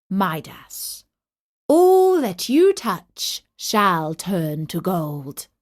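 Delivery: slow, weighty pronouncement; solemn god's decree. Acted. God grants the king's wish, slow and solemn.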